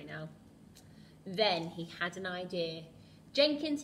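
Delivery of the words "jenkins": high, loud call to the butler. Acted. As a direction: call Jenkins across the room. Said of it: "he cried".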